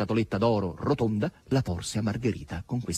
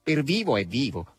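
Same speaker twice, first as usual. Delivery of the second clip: grudging admission, pitched up; reluctant; 5 semitones higher, slower than usual. A narrator's reading. Asasello admits he is alive, reluctantly. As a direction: admit it unwillingly. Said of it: "rispose a malincuore Asasello".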